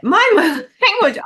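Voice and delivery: laughing. Real, not acted. Laughs at her own swearing.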